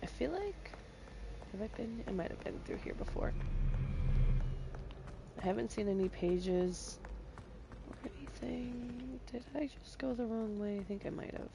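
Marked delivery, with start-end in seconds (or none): none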